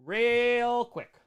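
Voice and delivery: drawn-out Midwest vowels; elongated Wisconsin O's. Shown as a demonstration. Streamer demoing how the Wisconsin O stretches. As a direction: stretch the o in "quick" and "Those O's" long and slow. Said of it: "Those O's get real elongated".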